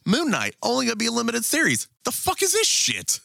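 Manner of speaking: very high, incredulous retelling; mock outrage. Retelling indignant reaction to series news.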